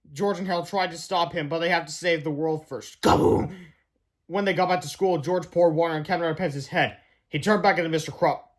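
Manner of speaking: ordinary delivery, nothing marked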